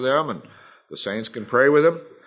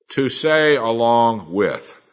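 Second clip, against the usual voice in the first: slow repeated definition; 4 dB louder, much slower than usual. Shown as a demonstration. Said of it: "Let me tell you what the word confess means".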